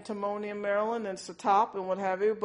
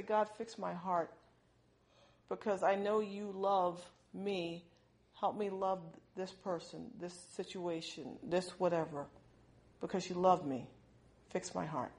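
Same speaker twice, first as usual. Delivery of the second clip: quiet prayer; 7 dB quieter than usual. Acted. Believer asking God for help to love, softly pleading.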